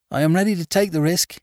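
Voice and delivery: high, loud and stark; stark interjection. A narrator's reading. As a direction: cut in with bare resolve. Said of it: "interposed Missus Grimethorpe starkly".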